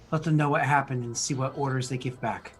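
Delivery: ordinary delivery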